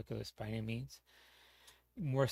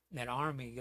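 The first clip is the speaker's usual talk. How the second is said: ordinary talk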